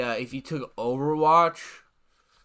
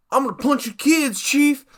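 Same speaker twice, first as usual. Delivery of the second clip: higher, louder hammed-up threat; mock game hero threat; 10 semitones higher, 7 dB louder than usual. The game hero answers a kind question with a hammed-up, higher, louder threat. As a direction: blurt it like a dumb video game hero. Dialogue option comes out as threat.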